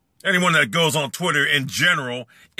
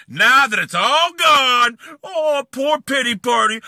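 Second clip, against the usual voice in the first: slow, loud, higher mock crying then laugh; mock pity wail; 6 semitones higher, 4 dB louder, slower than usual. Real, not acted. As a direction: sob sarcastically at him. Mocking rival's self-pity.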